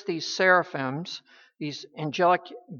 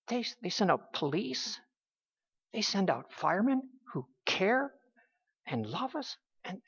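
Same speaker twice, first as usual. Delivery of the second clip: usual speaking voice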